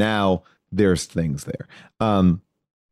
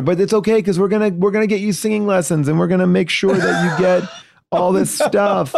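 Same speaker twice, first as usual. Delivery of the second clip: hammed-up cheery host; 10 semitones higher than usual. Host consoling the loser, high fast cheery mock promise. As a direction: sell the consolation prize like a chirpy game show host.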